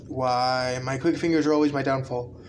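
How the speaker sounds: ordinary talk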